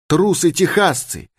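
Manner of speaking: high, indignant woman's cry; angry exclamation. A narrator's reading. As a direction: exclaim it in anger, pitched well up. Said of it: "воскликнула она гневно". (гневный возглас высоким голосом)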